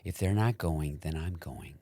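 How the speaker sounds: put-on softly determined Chicago accent; Sister Terry. Aunt insists she's going, hushed Chicago accent. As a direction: Midwestern aunt, fading toward a whisper. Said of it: "she's from Chicago".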